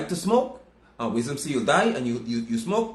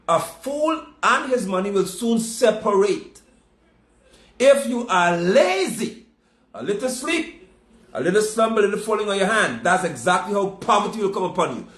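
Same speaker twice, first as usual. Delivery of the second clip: raised, driving preacher's voice; preaching at full force; 6 semitones higher, 3 dB louder than usual. Acted. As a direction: preach it hard to the congregation.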